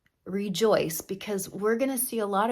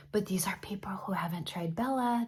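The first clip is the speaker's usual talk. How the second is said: breathy secret whisper; 4 dB quieter than usual. Real, not acted. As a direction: lean in and whisper it. Confiding aside about her product.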